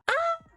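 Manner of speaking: short, very high squeak; sudden high-pitched "ah" of delight; excited squeal. Real, not acted. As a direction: let out a tiny high squeal. Reacting to mention of her Grim Reaper.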